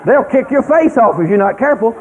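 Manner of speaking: usual speaking voice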